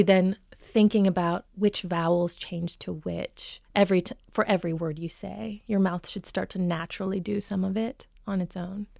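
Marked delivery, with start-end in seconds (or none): none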